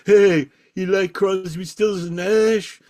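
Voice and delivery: high, slow, drawn-out DJ hype; local DJ. Retold or imitated. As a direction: work the crowd like a local DJ. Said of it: "The local D J goes".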